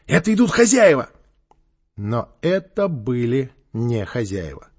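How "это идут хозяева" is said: high, loud warning cry; alarmed shout. A narrator's reading. Boy warning that the owners are coming, alarmed shout. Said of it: "крикнул вдруг кто-то".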